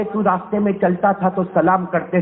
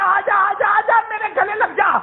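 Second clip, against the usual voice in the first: calling out; 10 semitones higher than usual. Acted. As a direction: call the son into your arms. Imagined father calling son.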